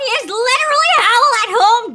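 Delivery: high and loud gush; excited gushing. Real, not acted. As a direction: squeal it in delight. Gushing about voice.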